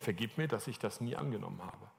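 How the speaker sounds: low, hushed plea for forgiveness; quiet, humble confession to God; penitent prayer. Retold or imitated. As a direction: pray it softly, in repentance. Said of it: "Ich hab Buße getan".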